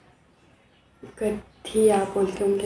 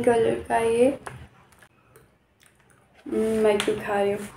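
words muffled by chewing; talking while chewing. Real, not acted. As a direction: mouth partly full of peanuts. Seen 0: Pours snack into mouth at -3, grimacing and chewing during words.

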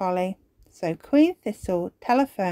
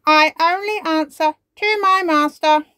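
high, louder mechanical robot voice; the robot names Ben its master; 9 semitones higher, 8 dB louder than usual. Acted. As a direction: declare it like a toy robot. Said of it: "says the robot".

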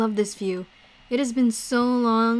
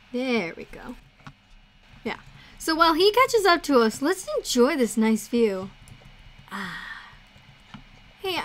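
ordinary delivery

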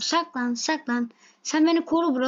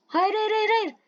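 panicked no; 6 semitones higher than usual. Real, not acted. Teammates die, game slipping away.

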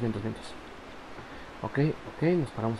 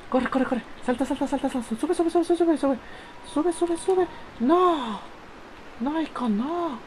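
high, tense pleading; frantic urging; 13 semitones higher, slower than usual. Real, not acted. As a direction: beg the character to climb. Urging the character across jump.

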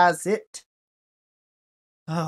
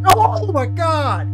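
mock scared shriek; 10 semitones higher, 7 dB louder, slower than usual. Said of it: "Sitting on my couch like".

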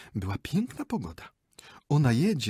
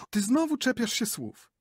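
much higher, peevish retort; indignant wife; 3 dB louder than usual. Acted. Wife scolds her husband for nitpicking, high and peevish. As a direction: brush him off, annoyed.

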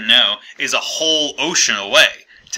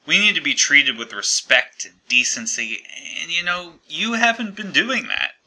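usual speaking voice

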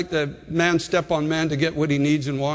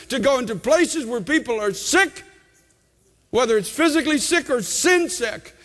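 higher, urgent preacher's delivery; impassioned preaching; 6 semitones higher than usual. Real, not acted. Preacher warning the congregation about eternity, impassioned preaching. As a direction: preach it with mounting urgency, punching key words.

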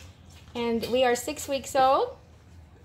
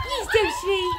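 high, cooing adoration; sweet, high-pitched puppy coo; 10 semitones higher than usual. Real, not acted. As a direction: gush over a puppy in a tiny high voice. Coos at puppies.

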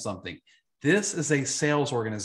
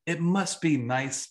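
ordinary talk

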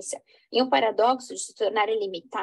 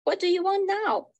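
higher pitched, pointed challenge; challenging demand; 8 semitones higher than usual. Acted. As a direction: confront the rival sharply. Rival confronts young man.

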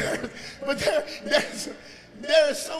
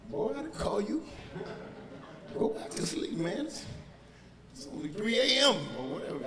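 woken-up Eli; 2 semitones lower, 6 dB quieter than usual. Acted. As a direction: grumble as if dragged from sleep. Acts Eli woken at night.

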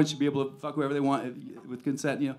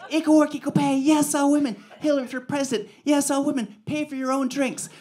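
much higher, mocking woman's voice; mock girlfriend voice; 11 semitones higher, 4 dB louder than usual. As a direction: squeak it out high like a preachy girlfriend.

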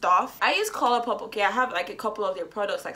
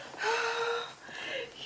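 sharp excited gasp; delighted gasp; 13 semitones higher, 7 dB quieter than usual. Real, not acted. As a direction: gasp at the sight. Sees the palette.

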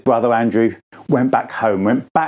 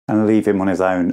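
ordinary talk, nothing marked

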